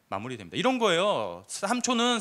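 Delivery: ordinary delivery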